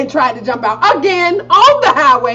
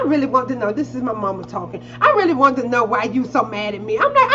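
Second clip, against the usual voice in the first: ordinary talk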